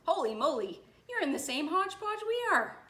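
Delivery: loud, high-pitched amazement; astonished exclamation. A narrator's reading. Mister Thomas realizes the nurse has it too, astonished. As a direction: burst out in startled realization.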